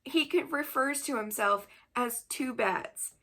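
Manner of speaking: usual speaking voice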